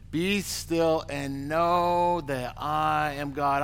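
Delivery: very slow, weighty "Be still and know that I am God"; slow scripture read. Shown as a demonstration. Preacher rereading the psalm, slow and solemn. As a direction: let each word of the verse settle slowly.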